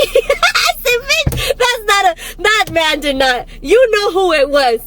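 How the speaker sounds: high-pitched, helpless laughing speech; cracking up. Real, not acted. Storyteller cracking up over the alien baby, laughing. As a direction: squeal with laughter through the words.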